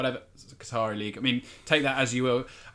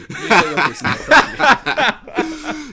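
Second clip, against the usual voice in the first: loud, dragged-out villain threat laughing through it; hammed-up mock threat; 13 semitones higher, 11 dB louder, much slower than usual. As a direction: boom it out like a cartoon villain, slowly.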